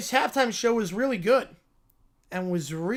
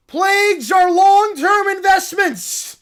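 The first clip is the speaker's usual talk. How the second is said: high, loud, slow cartoon bear voice; a cub thrilled by the news; excited little bear; 9 semitones higher, 10 dB louder, slower than usual. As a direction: squeal it like an overjoyed cub.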